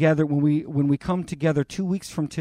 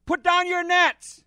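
high, loud call across the water; Jesus calling to the men in the boat, loud and slow; calling from shore; 14 semitones higher, 6 dB louder, slower than usual. Acted. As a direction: hail the fishermen from the beach. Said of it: "hears the Lord call from the shore".

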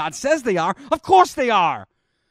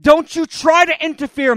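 higher, louder stern rebuke; stern warning; 7 semitones higher, 6 dB louder than usual. Acted. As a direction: warn him off sharply. God warns Moses off.

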